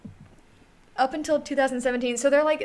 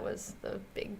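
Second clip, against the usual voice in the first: whispering; 2 semitones lower, 12 dB quieter than usual. Real, not acted. Aside trailing to whisper.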